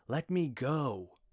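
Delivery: high, loud, slow cry to be let go; shouted plea. Acted. Rat shouting at Glawin to let her go. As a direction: yell it, fighting to break free. Captive girl shouts to escape.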